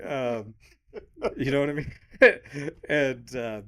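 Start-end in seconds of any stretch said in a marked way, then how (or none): 2.0-2.8 loud, high burst of laughter; sharp amused laugh. Real, not acted. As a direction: crack up briefly at your story. Amused by own story.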